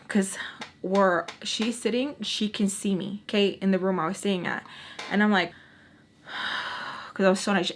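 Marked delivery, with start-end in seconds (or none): 6.3-7.1 soft, breath-only sigh; exasperated sigh. Acted. Granddaughter called over by grandma, exasperated sigh. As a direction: sigh at being summoned.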